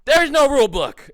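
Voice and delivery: loud emphatic declaration, no rule book; emphatic shout. Real, not acted. Vlogger shouting his point to viewers, loud and emphatic.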